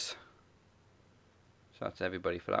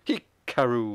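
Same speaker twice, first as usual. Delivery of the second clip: "Hikaru" raised and drawn out on the last syllable; Dasha; 4 semitones higher, 5 dB louder, slower than usual. Retold or imitated. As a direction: announce "Hikaru" with a long, loud "ruuu". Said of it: "gonna pronounce it the way".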